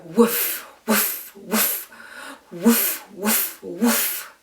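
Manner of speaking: "Woof" ending in a long pushed F; dog woofs, stretching the final F. Shown as a demonstration. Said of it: "really gonna enjoy the F sound at the end of woof".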